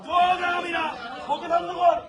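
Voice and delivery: high, repetitive confrontational shouts; real heated defiance; angry protest shouting. Real, not acted. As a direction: challenge them in short high bursts. Angry protest continues in crowd.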